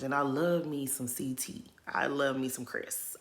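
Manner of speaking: soft unvoiced whisper, like a secret; whispered confession. Real, not acted. Confessing to viewers her crush, in a whisper. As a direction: lean in and whisper it.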